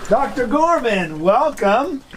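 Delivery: high, drawn-out welcome; hearty greeting. Pretend. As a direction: greet him with a big showy lift. Greets guest on walking in.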